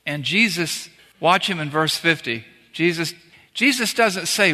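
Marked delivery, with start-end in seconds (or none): none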